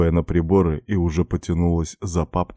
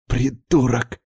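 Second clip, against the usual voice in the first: higher, mildly angry hiss; a touch of venom, pitched up; hissed insult; 6 semitones higher than usual. A narrator's reading. Katya insults the man pinning her, hissing. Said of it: "прошипела она".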